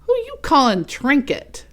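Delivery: mock-angry reindeer; offended reindeer. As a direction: snap back, insulted. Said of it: "got all bent and out of shape".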